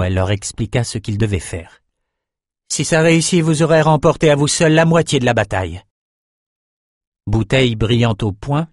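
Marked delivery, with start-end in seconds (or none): 2.7-5.8 rousing sorceress, raised pitch; lightly voiced sorceress. Sorceress encouraging fishermen before the dive, lightly voiced, rousing. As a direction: spur them on in a higher character voice.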